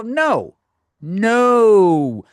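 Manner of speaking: loud, firm no; ringing no; emphatic no. Real, not acted. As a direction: shut the question down. Emphatic answer.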